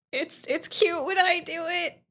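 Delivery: high, sweet cutesy voice. Pretend. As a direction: play it coy and cute. Cutesy repeat.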